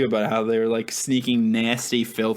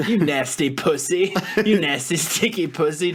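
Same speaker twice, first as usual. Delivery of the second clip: lightly voiced cartoon ogre, higher pitch; Shrek; 4 semitones higher than usual. Jokingly quoting Shrek's dirty joke to the cat, light impression. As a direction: put on a slightly raised ogre voice. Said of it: "in a Shrek voice".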